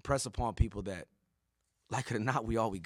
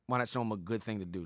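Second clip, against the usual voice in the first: ordinary delivery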